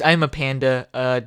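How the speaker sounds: ordinary delivery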